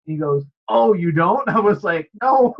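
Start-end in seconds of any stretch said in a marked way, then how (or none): 0.7-1.5 higher, louder mock surprise; taken aback, playing it up; startled retort. Retelling barber's startled reply. 2.2-2.5 high, loud retold "no"; re-enacted alarmed objection; emphatic refusal. Retelling own alarmed refusal.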